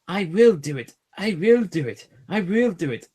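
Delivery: determined puffing. A narrator's reading. Edward pushing hard behind the train, determined puffing. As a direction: chug with stress on will. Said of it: "puffed Edward".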